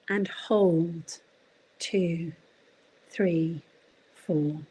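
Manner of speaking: calming breathing count. Shown as a demonstration. Talking listeners through box breathing one time, slow calm counting. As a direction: lead a breathing exercise, unhurried, voice settling downwards. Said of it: "my voice drops an octave when I'm doing that".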